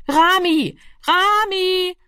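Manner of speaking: high, drawn-out searching call. A narrator's reading. Family searches house and yard for Rami, calling out high. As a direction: call a missing boy from afar. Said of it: "tönte es von hier und dort".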